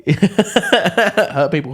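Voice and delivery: slow, high, laughing; laughing drawl. Real, not acted. Laughing at the co-host's phrase, drawn out slowly. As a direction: stretch it out through a laugh.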